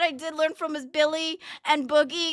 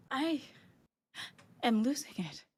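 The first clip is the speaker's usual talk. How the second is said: broken quiet; 4 semitones lower, 9 dB quieter than usual. Real, not acted. Streamer crying, falling apart.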